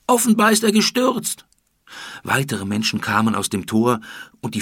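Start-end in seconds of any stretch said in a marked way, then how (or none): 0.0-1.5 loud, much higher scream. Acted. Someone in the crowd screams the news of the death. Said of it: "schrie jemand".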